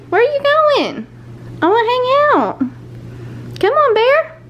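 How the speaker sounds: high, sing-song call to the dog; dog-talk voice. Real, not acted. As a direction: coax the dog back, pleading. Calling dog back.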